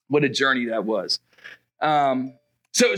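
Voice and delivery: ordinary delivery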